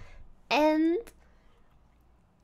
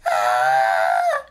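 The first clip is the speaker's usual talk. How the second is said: sudden yelp; 8 dB louder than usual. Real, not acted.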